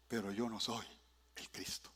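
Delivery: breathy whispered ending. Acted. Preacher whispering Christ's name to church. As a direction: drop it to a whisper for effect. Dramatic dropped close.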